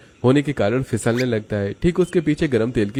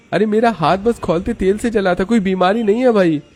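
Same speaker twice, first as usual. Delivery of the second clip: high-pitched indignant denial; exasperated protest, pitch jumping up; protesting worker; 11 semitones higher, 4 dB louder than usual. Acted. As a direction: push back hard against a false rumour. Akash denies the rumour.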